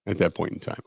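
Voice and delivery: softly repeating the phrase back, deadpan; quiet mock echo. Shown as a demonstration. Host mockingly echoes cohost's stock phrase, quietly. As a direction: echo the phrase under your breath.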